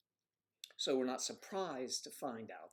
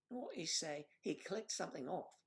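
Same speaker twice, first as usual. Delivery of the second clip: lightly put-on higher voice, questioning; imitated puzzled listener; 5 semitones higher, 4 dB quieter than usual. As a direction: mimic a baffled audience member, pitched up. Voices puzzled viewers reacting to him.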